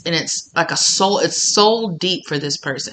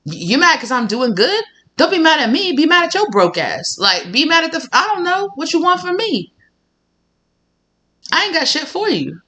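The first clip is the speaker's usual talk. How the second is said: high, mocking brush-off; sassy dismissal; 11 semitones higher than usual. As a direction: brush off the jealous one, pitch way up. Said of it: "It's like".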